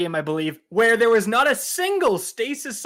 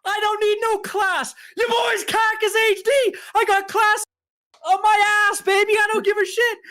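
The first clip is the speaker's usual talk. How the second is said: much higher and louder, a mock shouting brag; hyped boast; 11 semitones higher, 3 dB louder than usual. Bragging back at the Twitter troll, a high hyped shout.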